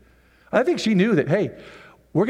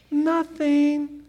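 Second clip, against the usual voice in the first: mock high; 12 semitones higher than usual.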